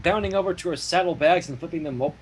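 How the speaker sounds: ordinary talk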